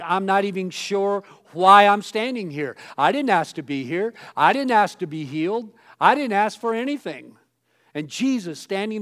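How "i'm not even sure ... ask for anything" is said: louder, bewildered protest of a man who never asked; healed man's thoughts. Retold or imitated. Preacher voicing the bewildered healed man, loud protest. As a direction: insist you never asked for any of this. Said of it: "may have even been thinking".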